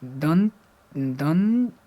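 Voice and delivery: दंत sounded out slowly, holding the "n"; dental sound demo. Shown as a demonstration. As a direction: stretch दंत as "dann", tongue at the teeth. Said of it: "ये दंत से हो जाएगा".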